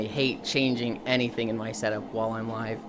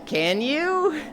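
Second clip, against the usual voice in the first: can you? leaping high; doubtful squeak of disbelief; incredulous question; 12 semitones higher than usual. Retold or imitated. Shocky and friend doubting the guesser, high and incredulous. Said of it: "just like".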